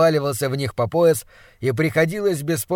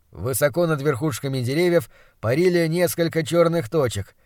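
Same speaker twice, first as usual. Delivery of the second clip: usual speaking voice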